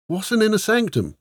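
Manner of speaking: high-pitched puzzled question; puzzled soldier. A narrator's reading. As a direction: ask it in a high, baffled voice.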